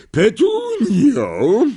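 high, loud drawn-out call of a name; shouting a name. A narrator's reading. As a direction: call her across the house. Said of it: "krzyknęła ciotka Merch". (głośne, wysokie wołanie)

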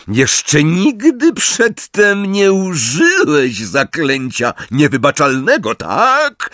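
high, slow taunting question; high-pitched, stretched-out jibe; calling out. A narrator's reading. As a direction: call it out, dropping the babyish chirp. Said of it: "porzucając szczebioczący ton".

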